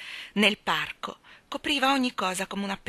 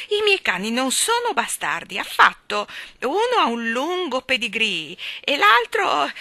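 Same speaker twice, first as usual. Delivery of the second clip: higher, louder, prim indignation; offended fussy lady; 6 semitones higher, 6 dB louder than usual. A narrator's reading. Miss Lark offended by language.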